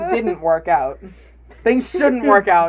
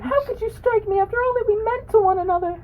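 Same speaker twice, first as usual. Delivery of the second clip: high-pitched hog voice; 14 semitones higher than usual. Acted.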